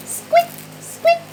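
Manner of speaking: high squeaky squeaks; mouse squeaks. Pretend. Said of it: "A mouse says".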